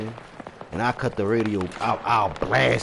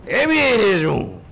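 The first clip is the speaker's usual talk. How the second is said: loud mock demand; 8 semitones higher, 4 dB louder than usual. Demanding into bedroom.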